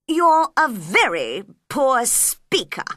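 loud, slow royal reproach; scolding king. Acted. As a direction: put the witness down slowly and loudly.

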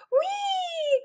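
very high, long squeal of glee; thrilled high "wheee"; gleeful "whee". A narrator's reading. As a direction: squeal like a kid on a galloping horse. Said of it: "cried Bob".

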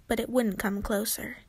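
usual speaking voice

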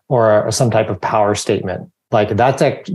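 usual speaking voice

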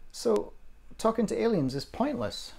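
high-pitched daughter's question; curious kid quizzing a parent. Acted. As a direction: raise the pitch well up, puzzled and asking. Narrator voices questioning young daughter.

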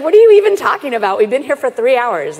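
high, loud, fast indignant outburst; loud, rushed exasperation at a stranger; indignant protest. Retold or imitated. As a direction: fire it back, outraged and quick.

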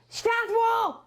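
sudden high shout of the word; loud shouted command. Shown as a demonstration. Teacher mimicking a parent yelling lights out at kids. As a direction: bark it out like a strict parent at lights-out. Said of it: "Czyli po polsku byśmy powiedzieli".

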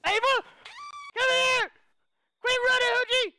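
high-pitched, worked-up shout at someone fleeing; chasing shout. Pretend. Player chasing Ava who keeps running, high chasing shout.

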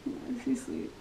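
whispering beside a sleeping newborn. Real, not acted. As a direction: breathe the words, no voice. Baby asleep next to them.

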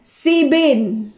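sibin with i vowels and a b; mispronounced seven. Retold or imitated. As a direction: imitate seven turned into sibin. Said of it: "Hindi talaga nila kayang i-pronounce". ("sibin" na panggagaya ng maling bigkas)

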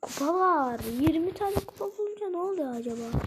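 usual speaking voice

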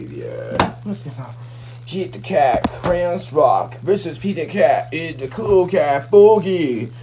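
ordinary delivery

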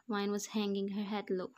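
ordinary delivery

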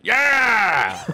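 hammed-up heckler whoop; imitated audience yell. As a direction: yell it out from the crowd. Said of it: "a lady yelled out".

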